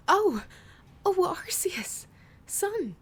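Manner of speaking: very high, loud cry of fright; startled shriek. Acted. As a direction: shriek in sudden terror. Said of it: "She shrieked in terror".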